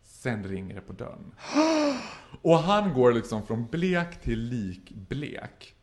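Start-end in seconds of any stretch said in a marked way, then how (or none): 1.4-2.4 sharp high intake of breath; high gasp. Retold or imitated. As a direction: gasp in shock. Gasp at doorbell. (flämtning)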